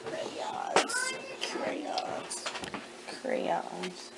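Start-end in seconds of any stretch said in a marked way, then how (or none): 0.0-2.4 whispered echo. Shown as a demonstration. Teacher echoing the child's choice, whispered. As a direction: whisper the word back high and light.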